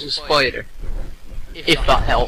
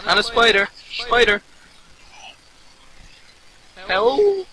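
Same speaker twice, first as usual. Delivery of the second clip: panicked cry for help; 7 semitones higher, slower than usual. Real, not acted. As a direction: cry out as the spider comes. Zombie and spider attack.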